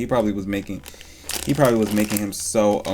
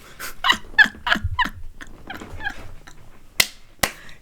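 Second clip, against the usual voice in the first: a long breathless cackle, pitched way up; high wheezing laugh; 3 dB quieter than usual. Real, not acted. Streamer wheezes at her own joke to chat. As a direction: lose it laughing, unable to speak.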